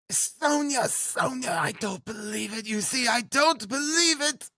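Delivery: high, anguished woman's voice; crying out in disbelief. A narrator's reading. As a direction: protest tenderly and desperately, very high. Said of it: "she cried".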